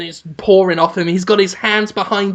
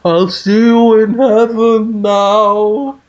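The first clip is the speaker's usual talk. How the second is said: high, slow, louder ghostly call from afar; 5 semitones higher, 5 dB louder, much slower than usual. Acted. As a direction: float it down like a ghost from the sky. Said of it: "ghostly voice just say".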